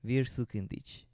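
slow, hesitant go at "Wir suchen dich"; "Wir suchen dich" as a learner's careful attempt at German; attempted German pronunciation. Shown as a demonstration. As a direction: say "Wir suchen dich" slowly with an English-sounding "suchen". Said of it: "how would you pronounce this".

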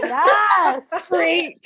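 loud, drawn-out yes with a laugh; delighted cheer. Retold or imitated. Woman offered a free drink by the bouncer, loud delighted cheer. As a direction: celebrate it loudly, laughing.

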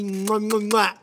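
kiss sounds. Real, not acted. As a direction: blow kisses into the mic. Kisses to his wife.

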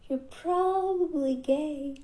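slow, high, thin voice giving out. Real, not acted. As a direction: voice slips up and cracks, slowly. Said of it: "I'm so sorry for my voice".

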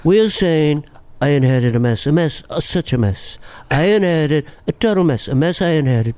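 boastful president, mumble mixed with nasal New York; self-satisfied presidential impression; Trump. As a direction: repeat yourself like a rambling president. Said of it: "It's like Trump is Brando and Regis".

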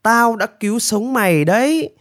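high, drawn-out lecturing voice; self-important high-voiced benefactor; demanding bird rescuer. A narrator's reading. Narrator voices man lecturing the bird.

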